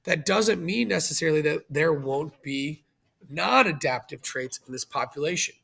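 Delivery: usual speaking voice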